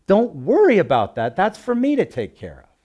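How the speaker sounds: put-on father telling a child not to worry about the bill; good father. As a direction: reassure a worried child. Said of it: "Like a good father".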